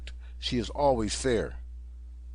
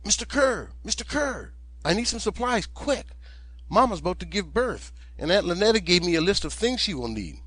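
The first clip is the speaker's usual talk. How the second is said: put-on high, breathless boy's voice, pleading and hurried; a frightened kid begging for help fast; urgent young boy; 6 semitones higher than usual. Young Jim begging the storekeeper for supplies, high urgent boy's voice.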